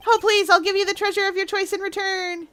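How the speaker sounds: high, imploring voice; begging client. Acted. Client offers treasure in return, begging. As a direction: plead on a sustained high pitch.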